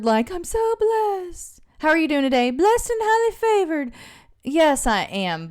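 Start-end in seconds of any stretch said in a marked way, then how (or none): 0.3-1.6 high, slow, sugary church-lady "I'm so blessed" with a long "so"; mock the churchy way of saying it, pitched way up. Mocking churchy talk to her viewers, high and drawn out. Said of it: "in a churchy, weird, like". 1.8-3.9 high singsong church-lady greeting; churchy mock greeting. Mocking church greetings for viewers, high singsong. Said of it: "in a churchy, weird".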